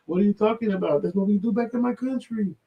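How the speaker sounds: very high mock voice; sketch character voice. Quoting the sketch character's excuse, very high voice. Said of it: "Key and Peele skit".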